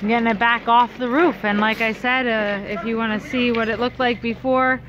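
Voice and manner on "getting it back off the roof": ordinary talk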